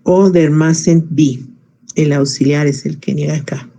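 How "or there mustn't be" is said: slowly, word by word, Or there mustn't be; slow, careful classroom example; slow teaching model. Shown as a demonstration. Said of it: "Vuelvo a intentar".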